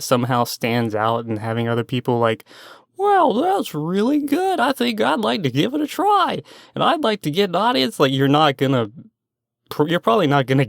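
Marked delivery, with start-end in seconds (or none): 3.0-8.0 imagined viewer voice. Retold or imitated. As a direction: play a naive, eager newcomer. Voicing hopeful new creators.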